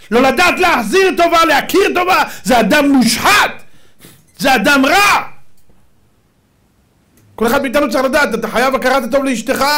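high, shouted denunciation; loud, impassioned rabbinic rebuke; fiery preaching. Real, not acted. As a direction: thunder at the congregation. Preaches at full force.

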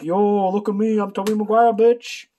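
hammed-up cocky superhero brag; cocky Spider-Man. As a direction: show off like a brash teen hero. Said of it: "And then Spider-Man's like".

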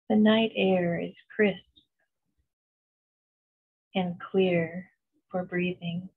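slow, even meditation voice with long pauses; unhurried, soothing visualization, letting each phrase settle; guided meditation. Acted. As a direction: guide them gently into calm, phrase by phrase.

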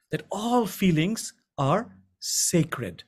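very slow and grave, leaning on all; slow solemn emphasis. Shown as a demonstration. Teacher impressing a key truth on the class, slow and solemn. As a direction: let each word of that all feelings are sacred land slowly. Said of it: "I repeat".

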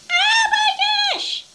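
high, loud surprised squeal; startled squeal. Acted. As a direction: shriek out in sudden shock. Reacting to the vinegar.

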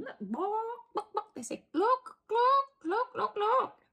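high, loud clucking look look; excited hens. Acted. The hens excitedly calling everyone to look. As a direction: squawk it like thrilled hens.

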